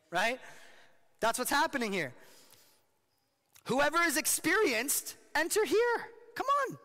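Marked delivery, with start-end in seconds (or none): 3.6-6.1 sing-song beckoning call, much higher; Lady Folly beckoning. Acted. Voicing Lady Folly's invitation. 6.3-6.8 sing-song beckoning call, much higher; Lady Folly beckoning. Acted.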